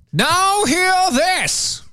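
slow, high proclamation voice; town crier. Retold or imitated. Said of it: "His email read something like this".

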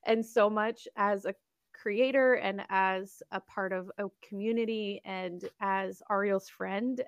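ordinary talk

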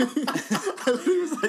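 high, slow, dissolving in laughter; can barely get it out for laughing. Real, not acted. Laughing at Dave eating.

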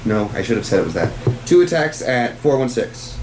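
ordinary delivery

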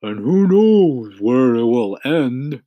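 gloomy grumble, not hushed; muttering earthworm. A narrator's reading. Earthworm doubting the centipede's journey, gloomy grumble. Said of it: "muttered the earthworm".

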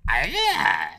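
slow put-on voice; drawled mock line. Comedian playing the girl in a sketch, slow put-on voice. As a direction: drawl it out like a silly date. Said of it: "I'll be like".

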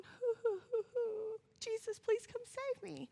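high, soft whimpering mock cry; mock a timid little whimper; hammed-up weak, quiet wail; feeble mock plea. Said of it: "He wasn't just sitting there going".

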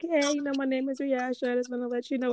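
a hint of a Jamaican accent, introducing herself; mild Jamaican accent impression; imitated Jamaican friend. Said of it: "with her Jama-, Jamaican accents".

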